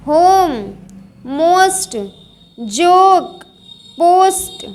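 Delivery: words like सो, नो, होम with a stretched "oh"; long o. Shown as a demonstration. Teacher drilling the long vowel, सो and नो with long "oh". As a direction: draw out the "oh" in होप and जोक, word by word. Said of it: "बड़ा वाला लंबा स्वर".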